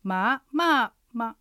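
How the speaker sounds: ま ま ま in rising, falling, light tone; tone sequence. Shown as a demonstration. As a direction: step through ま ま ま as second, fourth, neutral. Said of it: "アクセントの流れを確認するなら".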